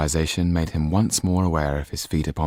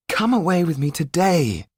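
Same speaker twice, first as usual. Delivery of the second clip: pleading, pitched higher and louder; earnest begging; 12 semitones higher, 4 dB louder than usual. A narrator's reading. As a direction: beg her to come away. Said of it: "begged Yan".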